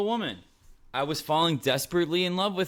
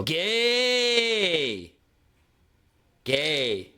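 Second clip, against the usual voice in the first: taunting inner voice; 4 semitones higher, 3 dB louder than usual. Retold or imitated. Inner critic mocking his love confession, loud jeer. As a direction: heckle him like a schoolyard bully. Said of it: "I hear my inner voice go".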